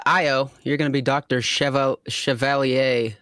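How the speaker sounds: normal talking voice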